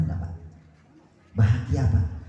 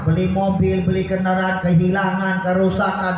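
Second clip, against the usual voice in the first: building fervent list; 8 semitones higher than usual. Acted. Escalating list of misfortunes.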